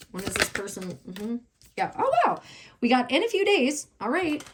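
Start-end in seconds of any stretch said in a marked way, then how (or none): 2.0-2.4 surprised wow. Real, not acted. Reader to her viewers, spotting a card: a high surprised wow. 2.8-4.4 higher and bright with the good news; excited. Real, not acted. Reader telling viewers the timing is soon: excited and high.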